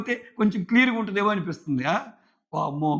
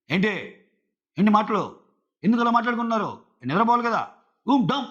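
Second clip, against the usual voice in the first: higher, louder, snapping at them; harsh scolding; 4 semitones higher, 3 dB louder than usual. Shown as a demonstration. Scolding housemates for talking at bedtime, harsh and loud. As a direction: bark it out like an angry order. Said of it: "boom boom బుసక్ అన్నట్టు ఉంటది".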